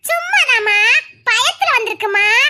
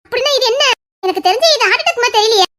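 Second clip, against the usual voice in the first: ordinary talk, nothing marked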